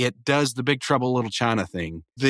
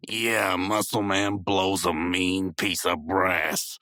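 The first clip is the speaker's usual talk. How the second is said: lower, slow and soft drawl; Skips; 5 semitones lower, 3 dB quieter, much slower than usual. Retold or imitated. Reviewer imitating Skips praising Muscle Man, low and slow. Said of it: "Skips goes".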